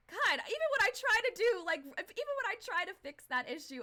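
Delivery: high, loud self-reproach; exasperated. Real, not acted. Streamer failing to fix the audio, loudly exasperated. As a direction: vent loudly at your own mistake.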